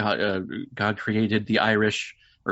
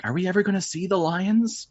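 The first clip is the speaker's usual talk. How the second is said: put-on wondering kid voice; 9 semitones higher than usual. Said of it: "I remember wondering as a kid".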